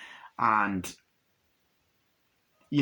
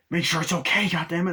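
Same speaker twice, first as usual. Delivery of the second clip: higher, louder mock argument; heated film quote; 7 semitones higher, 5 dB louder than usual. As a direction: act out an exasperated demand. Acted tense argument from film.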